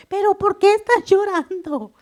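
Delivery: put-on child's worried question. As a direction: voice a little boy asking, alarmed. (niño preguntando preocupado, voz aguda y fuerte)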